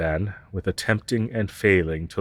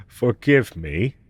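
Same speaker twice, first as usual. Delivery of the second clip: normal talking voice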